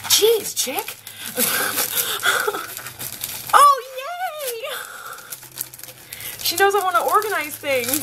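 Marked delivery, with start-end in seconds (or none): 0.1-1.0 high squeaky gasp of delight; thrilled high-pitched exclaim; excited squeal. Real, not acted. Squealing at an opened gift. 1.0-3.5 high breathless giggle; soft high giggle; airy laugh. Real, not acted. Laughing at the gift. 3.6-4.8 very high delighted yay; thrilled high cheer; excited squeal. Real, not acted. Cheering at the gift.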